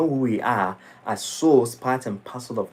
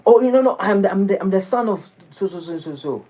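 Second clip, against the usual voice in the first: much higher, flustered stammer trailing off; startled; 8 semitones higher than usual. Retold or imitated. Braggart startled by a simple question, flustered stammer. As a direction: caught off guard, groping for words. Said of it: "he will startle a bit".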